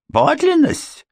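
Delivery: very high startled question; alarmed echo. A narrator's reading. Housekeeper startled by Ford's word, high alarmed.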